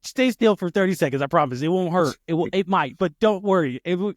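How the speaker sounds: high, loud, insistent coaxing; mock reassurance. Dad about to pull his child's tooth, mock reassuring. Joking promise before pulling tooth.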